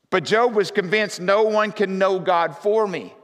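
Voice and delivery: loud, high preaching voice, pressing every line; full-force preaching. Acted. Pastor preaching on Job to his congregation, full force. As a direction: preach it to the rafters.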